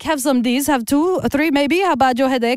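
pushy mock Russian accent offering pills; lightly hammed Russian accent. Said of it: "how good I'm doing this accent".

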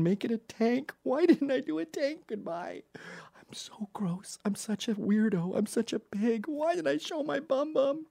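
put-on high, hushed child's prayer; a mortified kid begging God in falsetto; ashamed child praying. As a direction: pray like a mortified little boy.

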